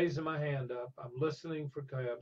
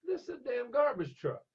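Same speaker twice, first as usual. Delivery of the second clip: gruff trucker; 11 semitones higher, 4 dB louder than usual. Retold or imitated. Trucker scolds, teller voices him.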